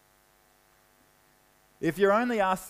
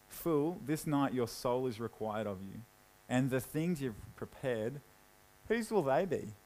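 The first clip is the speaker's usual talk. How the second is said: low, soft, dry and mocking; quiet sarcasm; 6 semitones lower, 8 dB quieter than usual. God mocking the rich man, in a low sarcastic voice. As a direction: let the irony sink in quietly. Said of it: "a little bit of sarcasm there".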